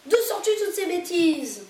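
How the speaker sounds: loud, high, forceful retort; energetic scolding. Acted. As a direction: bark the question back energetically. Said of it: "miaula énergiquement Zorba".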